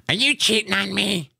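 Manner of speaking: high, put-on accusing wife voice; imitating the suspicious wife. Retelling his wife's morning accusation, high mocking voice. Wife confronts him, retold quote.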